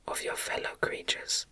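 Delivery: usual speaking voice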